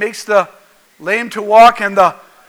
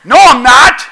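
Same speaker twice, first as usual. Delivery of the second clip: loud, raised defensive retort; touchy, louder protest; grumpy denial; 4 semitones higher, 8 dB louder than usual. Acted. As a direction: snap back in mock indignation. Acting out grumpy denial when called crabby.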